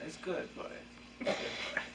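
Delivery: whispered pimp croon; smooth flashy pimp swagger. Acted. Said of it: "You're doing it again".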